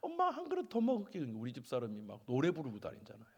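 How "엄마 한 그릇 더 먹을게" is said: put-on high child voice asking mom; a high-pitched little plea; child's request. Child to mother asking for another bowl, high child voice.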